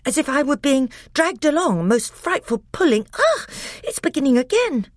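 high, loud breathless alarm. Acted. Edmund describes being dragged as it restarts, breathless and alarmed. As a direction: gasp it out as the tug returns. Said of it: "in a breathless voice".

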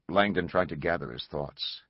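usual speaking voice

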